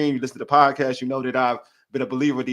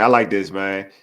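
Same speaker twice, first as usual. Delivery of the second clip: ordinary delivery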